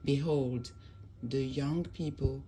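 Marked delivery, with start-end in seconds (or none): none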